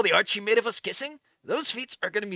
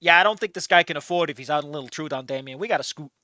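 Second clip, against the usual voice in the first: ordinary delivery, nothing marked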